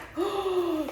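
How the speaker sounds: sharp high gasp. Real, not acted. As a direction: gasp in amazement. Seeing the knife.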